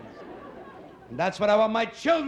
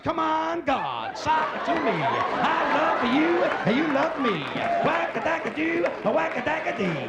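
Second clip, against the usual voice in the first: put-on hippie church kids; 5 semitones higher than usual. Modern kids in church calling to God, higher mock groovy chanting. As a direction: ham up the modern kids praying. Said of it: "Today, kids walk in church".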